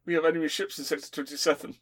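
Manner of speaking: loud, urgent radio call; hurried alarm to Obi-Wan. Acted. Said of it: "Obi-Wan, Obi-Wan".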